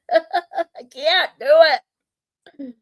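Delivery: ordinary talk, nothing marked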